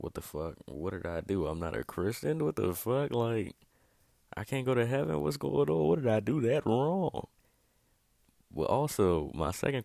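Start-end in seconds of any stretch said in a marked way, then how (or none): 0.0-7.3 mock panic. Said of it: "you'll probably be like".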